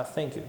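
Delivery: "thank you" with the tongue between the teeth, soft; a quiet "thank you" with air over the tongue; blown th. Shown as a demonstration. Teacher demos the blown th in "thank you". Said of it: "Ou então".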